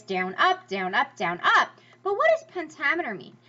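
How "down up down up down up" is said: alternating light "down" and stressed "up"; iambic rhythm. Shown as a demonstration. Teacher showing Shakespeare's meter, stress on each "up". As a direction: chant it with the weight on every "up". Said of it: "rhythm or meter is".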